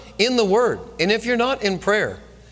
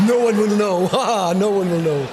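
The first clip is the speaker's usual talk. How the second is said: gleeful laugh. Retold or imitated. Man laughing at his plan to eat the popcorn secretly. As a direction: chuckle over your secret plan.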